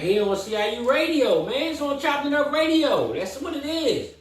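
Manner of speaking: high, loud sign-off call; hype announcer shout. Real, not acted. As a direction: belt the outro like a radio host.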